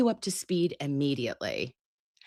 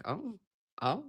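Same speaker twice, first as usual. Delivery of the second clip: breathy little oh oh under the breath; quiet stunned oh; hushed shocked gasp; 3 semitones higher, 12 dB quieter than usual. Retold or imitated. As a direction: mimic being stunned silent. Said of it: "I clutched".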